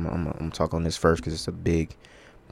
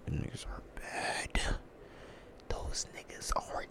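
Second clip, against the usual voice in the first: slow, whispered verdict; hushed disbelief; 18 dB quieter than usual. Real, not acted. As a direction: drag it out in a low-volume whisper. Hushed verdict on bad receivers.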